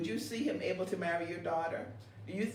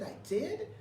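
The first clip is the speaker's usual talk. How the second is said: surprised question; 7 semitones higher, 3 dB quieter than usual. Retold or imitated. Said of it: "And I said".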